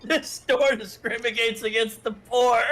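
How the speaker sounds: hammed-up announcement to the whole shop; mock public outcry. Mimics the player's in-game public complaint.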